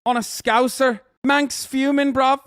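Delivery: high, loud mocking English lad accent. Mocking Man United fans, in a hammed-up English lad accent. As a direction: ham up an angry English football lad accent.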